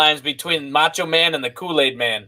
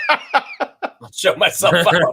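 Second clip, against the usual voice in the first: slow words through laughter; laughing; 2 semitones higher, much slower than usual. Real, not acted.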